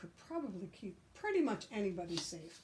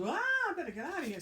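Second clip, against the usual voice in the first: high, fast frightened dash; little pig fleeing the wolf in a hurry; panicked squeal; 9 semitones higher, 5 dB louder, much faster than usual. Acted. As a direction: blurt it out in a panic.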